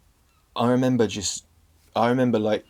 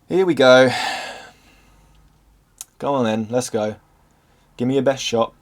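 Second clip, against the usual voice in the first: defiant taunt; 2 semitones higher, 6 dB louder than usual. Retold or imitated. Taunting the oncoming panic attack.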